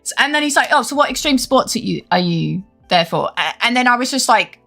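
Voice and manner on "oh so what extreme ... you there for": normal talking voice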